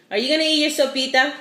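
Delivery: high fast coaxing voice. Real, not acted. Coaxing the baby to eat.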